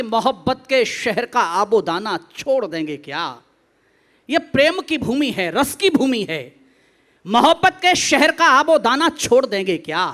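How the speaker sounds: loud, ringing declaimed verse; stage poet putting a question to the crowd; poetry recitation. Acted. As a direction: recite forcefully to a big audience.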